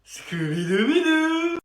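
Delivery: slow, high cartoon dog voice; goofy cartoon dog sing-song; Scooby-Doo voice.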